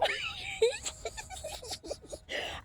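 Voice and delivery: high, breathy burst of laughter; laughing at her own jab. Real, not acted.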